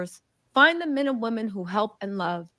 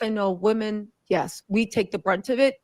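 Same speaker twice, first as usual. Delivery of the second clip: normal talking voice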